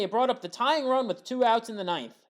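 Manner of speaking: ordinary talk, nothing marked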